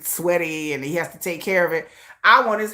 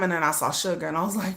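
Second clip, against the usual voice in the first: normal talking voice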